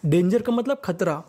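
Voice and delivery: ordinary talk, nothing marked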